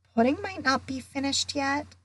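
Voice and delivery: a small lift of hope in the voice; hopeful. A narrator's reading. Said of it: "said Ron hopefully".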